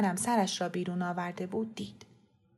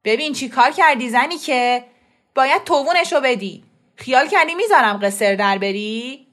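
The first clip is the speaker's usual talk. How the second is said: loud, raised-pitch scolding shout; angry yelling; 4 semitones higher, 10 dB louder than usual. A narrator's reading. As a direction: bawl them out, demanding payment. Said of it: "داد کشید". (داد زدن با عصبانیت)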